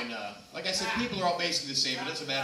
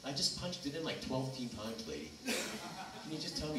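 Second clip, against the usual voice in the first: mild mock exasperation; impatient customer; 7 dB quieter than usual. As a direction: plead with the operator, worn out. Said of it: "the lady comes on the phone".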